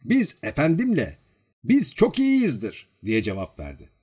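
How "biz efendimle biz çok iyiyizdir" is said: high, drawn-out bragging; boastful. A narrator's reading. Servant praising himself and his master, boastfully. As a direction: puff yourself up proudly. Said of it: "Vanyusha böbürlenerek".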